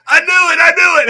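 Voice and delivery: high, loud triumphant crow; gleeful gotcha. Retold or imitated. Rival wrestler crowing over a knocked-out tooth, high and loud. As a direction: crow it like you caught him red-handed. Gloating rival bursts back in.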